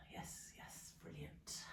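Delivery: barely voiced, very soft; near-whispered encouragement to the class; hushed acknowledgement. Real, not acted. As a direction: murmur approval almost under the breath. Quietly acknowledging students' thumbs up.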